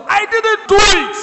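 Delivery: high mock shout of innocence; a defendant's high protesting yell; retold shout. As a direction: shout it up at the judge. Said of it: "the accused shouted on the judge".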